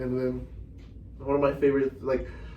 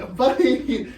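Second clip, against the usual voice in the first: laughing high denial; 10 semitones higher, 4 dB louder than usual. Retold or imitated. Caught dozing in church.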